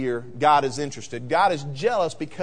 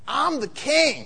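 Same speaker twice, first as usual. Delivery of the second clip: self-important king; 7 semitones higher, slower than usual. Acted. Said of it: "is full of himself".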